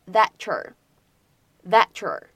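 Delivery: "thatcher" with "that you're" merged into one word; assimilated linking. Shown as a demonstration. Teacher modelling "that you're" blended as "thatcher". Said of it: "可以产生音的同化".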